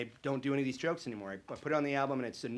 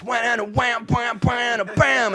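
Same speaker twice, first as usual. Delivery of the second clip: loud, high imitation of power chords; vocal air-guitar blasts; mouthed guitar riff; 11 semitones higher, 12 dB louder, much slower than usual. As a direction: belt out a mock electric guitar riff.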